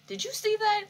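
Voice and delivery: high incredulous question; shocked question. Real, not acted. Asking viewers about the hand, stunned. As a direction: ask it in disbelief.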